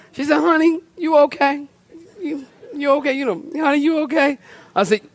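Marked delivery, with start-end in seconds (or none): 0.4-4.4 higher, gentle concerned wife. Retold or imitated. Wife checking on her tearful husband, lightly voiced and tender. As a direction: ask tenderly like a worried wife.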